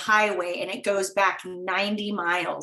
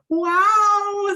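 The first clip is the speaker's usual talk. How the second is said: wow, much higher and louder, awed; mock-awed wow, pitched up; amazed wow; 12 semitones higher, 7 dB louder than usual. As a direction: gasp it out like you can't believe it. Retold amazed reaction to guest.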